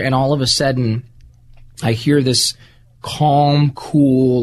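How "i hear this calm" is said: normal talking voice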